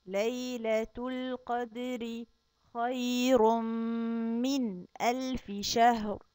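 slow, drawn-out tajweed chanting; Quran recitation. Acted. Teacher reciting Surat al-Qadr to kindergarten kids, slow chant. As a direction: chant each word slowly for the children.

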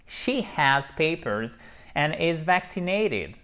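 slow, with "papers" stressed as PA-pers; slow model reading. Shown as a demonstration. Teacher recapping the sentence slowly to the class. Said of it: "model sentence after drill".

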